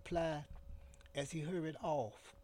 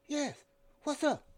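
voiced Sunbolo; 12 semitones higher, 6 dB louder than usual. A narrator's reading. Sunbolo answering urgently.

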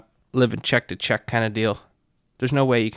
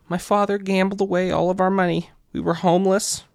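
son's sob story; 8 semitones higher than usual. Retold or imitated. Son tells his sad story.

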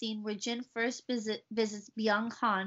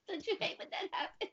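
laughing; 8 semitones higher, 8 dB quieter than usual. Real, not acted. Laughing about empty drink.

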